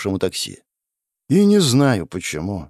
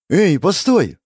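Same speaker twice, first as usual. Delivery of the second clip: alarmed higher call to stop him; girl stops the hurt friend leaving, urgent call; 8 semitones higher, 4 dB louder, slower than usual. Acted. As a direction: jump up and call him back. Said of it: "подскочила Вайлет".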